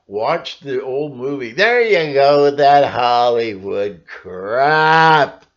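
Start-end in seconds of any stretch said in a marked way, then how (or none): 1.5-5.5 loud, slow, scoffing heckler voice; mock skeptic scoff. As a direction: sneer it like a dismissive doubter.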